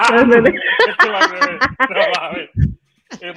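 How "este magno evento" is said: slow words squeezed out through laughter; laughing echo. Real, not acted. Guest echoing the host's pompous phrase, laughing. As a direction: repeat it while cracking up.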